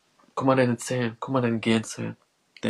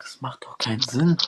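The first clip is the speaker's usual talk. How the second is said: normal talking voice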